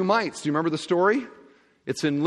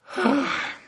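mock weary sigh; 11 semitones higher than usual. Jesus reacts to showy giving.

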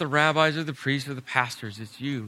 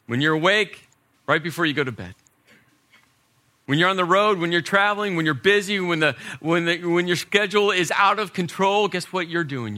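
higher, louder preaching build; 5 semitones higher, 5 dB louder than usual. Acted. Pastor urging parents to teach everywhere, rising preaching. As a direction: stack each phrase, climbing in force.

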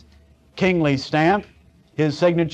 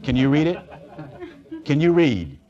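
ordinary talk

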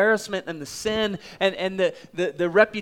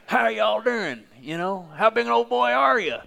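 higher, louder Oklahoma country voice; Oklahoma drawl; 6 semitones higher, 3 dB louder than usual. Acted. Disciples picking a fight with the village, in a mock Oklahoma twang. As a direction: play a rowdy Oklahoma good old boy. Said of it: "I'm from Oklahoma, so I can go there".

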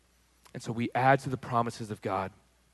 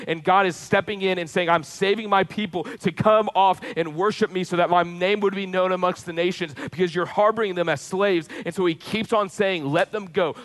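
higher and louder, driving sermon build; full-force preaching; 7 semitones higher, 6 dB louder than usual. Acted. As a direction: preach it with rising fervour to the congregation. Preaching Pharaoh story with force.

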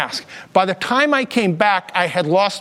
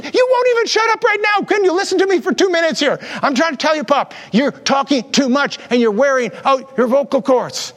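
exasperated daughter; 5 semitones higher than usual. Retold or imitated. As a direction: play the fed-up daughter lecturing Pop. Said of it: "what do you say".

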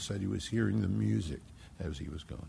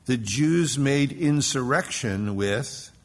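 slow emphatic reading; 3 semitones higher, 5 dB louder, much slower than usual. Real, not acted.

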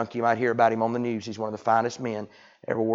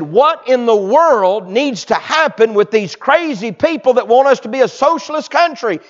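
riled-up commentator; 12 semitones higher, 10 dB louder than usual. Retold or imitated. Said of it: "he was right riled up".

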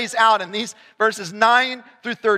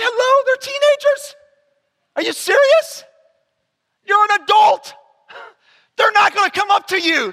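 higher and louder, mock-disbelieving scolding; incredulous exasperation; 12 semitones higher, 4 dB louder than usual. Youth pastor scolding his youth workers, incredulous and raised. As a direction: make it an over-the-top are-you-kidding-me. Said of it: "I'm going".

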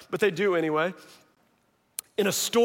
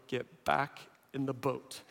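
wary urging; 6 semitones lower, 11 dB quieter than usual. Acted. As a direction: quietly urge everyone to retreat. Apostles urge retreat, warily.

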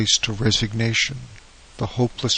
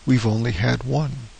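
ordinary delivery, nothing marked

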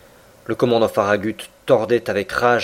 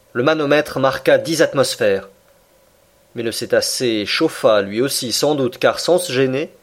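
plain reading voice

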